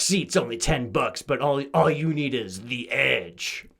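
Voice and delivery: slow, dramatic ad announcer; monster truck ad. Pretend. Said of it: "the monster truck commercials".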